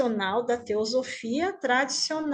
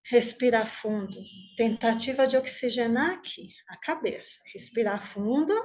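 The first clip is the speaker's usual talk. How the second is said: guided meditation; 3 dB quieter than usual. Acted. Teacher leading the group lying on imagined grass, guided meditation. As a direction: guide them gently inward, unhurried, leaving room to breathe.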